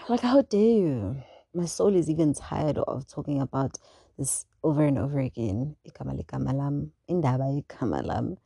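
ordinary delivery

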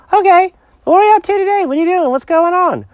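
high, loud, excitable cat voice; hyper cat Jenkins. Acted. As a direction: rattle off questions like an over-eager cat.

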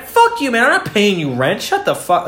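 high-pitched mock outrage; acted indignant refusal; mad. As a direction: blow up at a roommate, half in play. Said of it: "makes you so mad".

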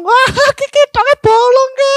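very high, drawn-out squeal; imitated friend's outcry. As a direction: squeal it slowly as the startled friend. Acting out friend's reaction to prank. (niru kancane njerit dawa)

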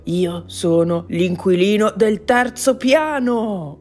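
slow, loud roar; drawn-out, emphatic shout; yelling. A narrator's reading. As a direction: bellow your identity at them slowly. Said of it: "urlò".

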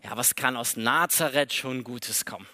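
low, unimpressed shrug of a question; dismissive. Acted. Nathanael brushing off his excited friend, dry and dismissive. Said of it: "was für eine Euphoriebremse".

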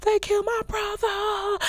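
high, hammed-up wail of grief; mock screaming. Retelling the scene, imitating his theatrical yelling. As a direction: overact a fake grieving scream. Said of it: "he's screaming".

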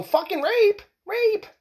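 hammed-up high princess yelp; mock outraged princess. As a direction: play the kissed princess waking up appalled. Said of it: "Couldn't she wake up and go".

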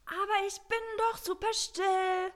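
innocent protest. Acted. Megan protesting she is quiet.

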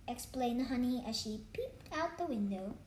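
ordinary delivery, nothing marked

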